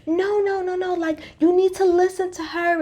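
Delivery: high, pleading voice; mock pleading Lisa. Imitating Lisa chasing Meredith to make her listen, high pleading. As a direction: beg her to stay and listen. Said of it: "she's like".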